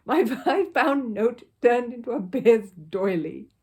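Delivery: slow, loud bitter complaint. A narrator's reading. Mr Curry, staring at his torn note, complains slowly and loudly. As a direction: drag out each word in disgust. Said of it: "repeated Mister Curry bitterly".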